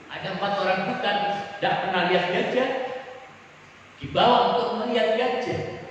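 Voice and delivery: usual speaking voice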